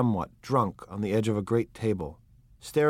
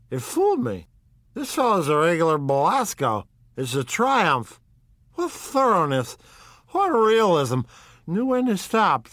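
put-on climbing higher and louder in drunken admiration; tipsy owl-eyed man, rising to rapture; 7 semitones higher, 4 dB louder than usual. A narrator's reading. Drunk guest raves about the host's real books, giddy. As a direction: marvel at the trick, ever more giddy.

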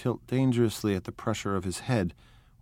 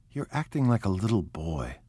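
usual speaking voice

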